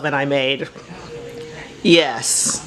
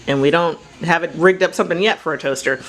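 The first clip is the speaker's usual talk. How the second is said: normal talking voice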